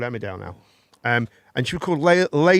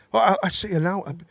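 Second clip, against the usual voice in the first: usual speaking voice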